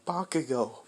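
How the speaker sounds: ordinary delivery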